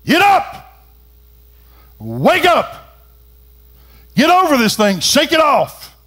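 high, forceful shouted commands; loud voice. Acted. Preacher as Jesus raising the girl, in a loud voice. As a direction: shout each order out, high and strong. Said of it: "says in a loud voice".

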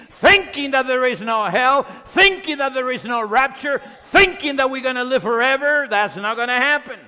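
rhythmic pulpit warning, punching each repeated opening; urgent, emphatic preacher's rebuke; fired-up preaching. Real, not acted. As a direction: drive the warning home in rising waves.

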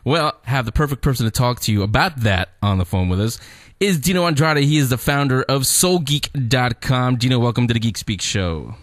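normal talking voice

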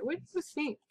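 ordinary delivery

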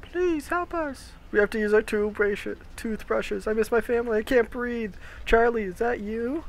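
high, small, soft voice; pleading captive. Acted. Captive begging Charlie for help, high soft plea.

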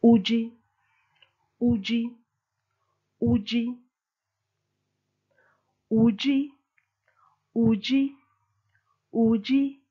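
Uji repeated, each high and falling; Chiluba drill. Shown as a demonstration. Teacher drills Uji for you are. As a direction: drill Uji six times with even pauses. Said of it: "Here we go for you are".

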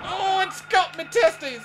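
very high, loud pained cry; panicked shriek. Real, not acted. Monster grabs him.

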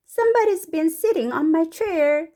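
high, loud, slow character voice; slow, emphatic complaint; indignant story mother. Acted. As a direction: read as an astonished storybook mum. Mrs. Blair voiced in story.

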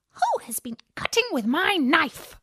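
a high, puzzled little dwarf. A narrator's reading. Dwarf wondering about his knife, high and puzzled.

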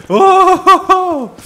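high laugh into a loud whoop; pained laughter. Real, not acted. Player close to crying after shock, laughing it off loudly. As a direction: laugh helplessly, then whoop.

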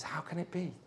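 whispered, very soft question; hushed disbelief. Retold or imitated. Upset husband finds a young man with his wife, hushed disbelief.

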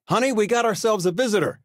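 high, loud, fast call to his wife; excited call. Acted. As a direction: call out the good news across the house. Calling out to spouse.

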